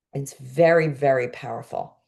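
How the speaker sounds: ordinary delivery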